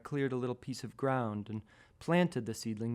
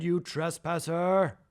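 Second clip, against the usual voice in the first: slow, loud booming giant voice, higher; angry giant; 6 semitones higher, 5 dB louder, slower than usual. Acted. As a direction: thunder down at the tiny seedling like a giant. Giant scolds the trespassing seedling.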